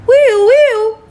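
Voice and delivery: high, loud imitated siren wail, whew whew; mock siren. Imitating police sirens heard nearby.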